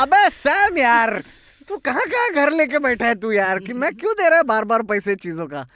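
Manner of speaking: high, loud, hammed-up exasperation; exasperated complaint. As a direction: retell a fed-up rant at a friend, fast and high. Exasperated outburst at freeloading friend Sam.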